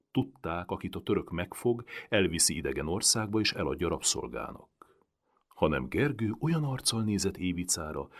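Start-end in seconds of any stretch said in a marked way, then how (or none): none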